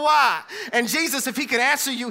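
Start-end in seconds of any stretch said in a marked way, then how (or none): 0.0-0.4 crying out to God. Acted. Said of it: "you crying to my God".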